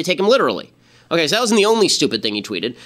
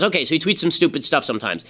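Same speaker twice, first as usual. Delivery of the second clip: ordinary talk, nothing marked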